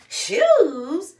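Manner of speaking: high, loud disbelieving shoes?; can't believe he's not thrilled; incredulous. Real, not acted. Mocking her boyfriend's shrug over new shoes, incredulous.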